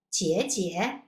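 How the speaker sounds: both syllables of 姐姐 given full third tones; non-native full tones. Shown as a demonstration. Teacher showing the non-native way, 姐姐 in two full dips. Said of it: "that's not a native way".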